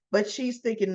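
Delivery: ordinary talk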